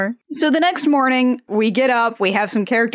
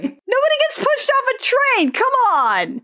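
much higher, outraged protest; indignant outburst; 13 semitones higher than usual. Real, not acted. Co-host mock outraged at the other host's guess. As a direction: shoot down the train theory in mock outrage.

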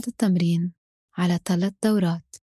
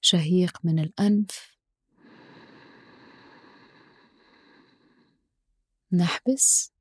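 slow, calm breathing cues; guided breathing; 4 dB quieter, slower than usual. Acted. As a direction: count the breath slowly and softly.

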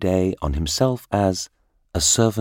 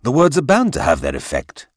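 higher, louder insistence; indignant cry; 8 semitones higher, 5 dB louder than usual. A narrator's reading. King insists to his minister, crying out. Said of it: "cried the king".

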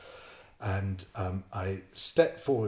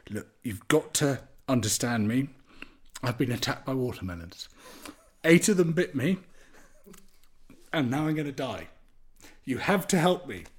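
higher, deliberate pleading with pauses; earnest plea; 5 semitones higher, 4 dB louder than usual. Retold or imitated. Stung man begging a seated villager for help, acted earnest desperation. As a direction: plead in all seriousness, phrase by phrase.

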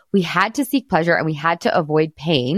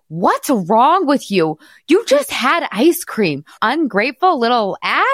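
slow, higher, mock-mean telling off; mock scolding; 7 semitones higher, slower than usual. Mother mocking how she won't scold her son, slow and mean. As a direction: ham up a mean parent. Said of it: "I'm not like".

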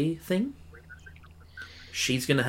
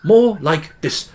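put-on higher, louder, slow over-the-top British accent; a failed go at a strange British accent; garbled British accent; 7 semitones higher, 7 dB louder, much slower than usual. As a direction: ham up a muddled British accent that drifts toward Australian. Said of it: "I can't even replicate it".

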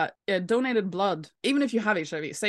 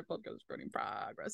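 whispered; 10 semitones lower, 11 dB quieter than usual. Real, not acted. Muttering while typing the message.

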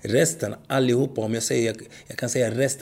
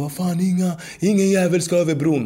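hammed-up bellow from a bitter coach, much higher and louder; mock coach yell; 7 semitones higher, 4 dB louder than usual. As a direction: bark it like an angry coach forbidding anyone to cross. Coach yelling at players, imitated.

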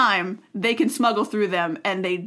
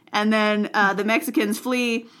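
ordinary delivery

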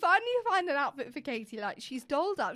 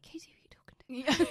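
whispering. Retold or imitated. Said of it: "I was like".

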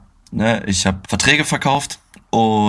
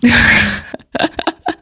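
high burst of laughter; 12 semitones higher, 4 dB louder than usual. Real, not acted. Laughs at the Schiff slip.

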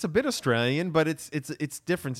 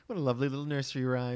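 mock sweet; 4 semitones higher, faster than usual. Mocking sweet rhyme praise.

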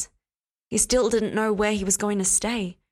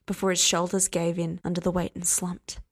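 plain reading voice

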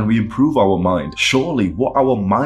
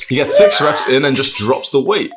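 movie trailer narration, slightly raised; dramatic narrator; 5 semitones higher, 4 dB louder than usual. A narrator's reading. Dramatic narration over music.

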